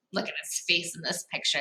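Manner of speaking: usual speaking voice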